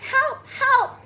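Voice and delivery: high, loud cries for help; frightened cry. Acted. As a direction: scream for help, terrified.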